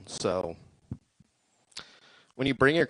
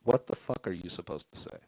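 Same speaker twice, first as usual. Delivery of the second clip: ordinary talk, nothing marked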